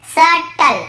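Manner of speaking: "सटल" sounded out as sat-tal; silent B. Shown as a demonstration. Teacher shows students subtle, "सटल" with B silent. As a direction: split "सटल" at the T, no B. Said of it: "यहाँ पे सबटल नहीं पढ़ रहे हैं".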